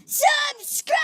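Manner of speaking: high screamed subscribe; screaming. Real, not acted. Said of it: "enough screaming".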